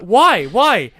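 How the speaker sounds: loud, high imitation of alarm; alarmed questioning. Alex asks Max why he must run, alarmed questioning. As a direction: cry it out in confusion.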